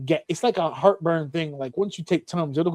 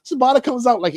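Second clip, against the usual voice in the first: ordinary talk, nothing marked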